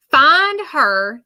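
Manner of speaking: high, drawn-out urging; urgent channeled plea. Retold or imitated. Medium relays a voice seeking someone, urgently. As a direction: send it out high and insistent. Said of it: "and then I hear".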